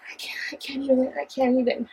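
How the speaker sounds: unvoiced whisper, words barely out; breathless whisper. Real, not acted. Reviewer overwhelmed by the plot twist, whispering. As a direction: whisper it as if too stunned to speak.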